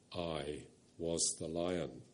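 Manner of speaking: a low, slow, gentle lion's voice. A narrator's reading. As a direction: rumble softly like a kind great lion. The Voice of Aslan.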